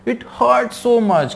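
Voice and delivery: high, very drawn-out pained words; mock tearful complaint. Schoolboy pretending his stomach hurts badly, mock tearful complaint. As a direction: stretch out the faked agony. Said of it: "है ना, इस तरीके से".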